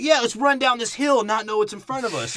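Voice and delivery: high, loud hammed-up cheer; mock knights. Friend mocks the Knights running downhill, high loud mock cheer. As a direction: charge in like eager fools.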